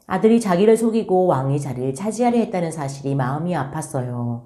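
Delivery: ordinary talk, nothing marked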